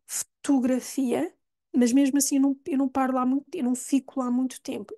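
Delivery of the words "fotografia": fotografia said slowly as futugrafia; slow model. Shown as a demonstration. Teacher modelling fotografia slowly for students. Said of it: "o segundo vai ter o som de U".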